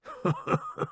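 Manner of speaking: soft, high chuckle; weak little laugh from the wounded soldier. A narrator's reading. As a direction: chuckle quietly at a friend's joke. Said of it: "Johnson chuckles".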